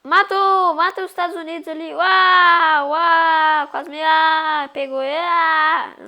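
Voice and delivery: high loud frantic yelling; excited yelling. Real, not acted. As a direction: yell through a near miss.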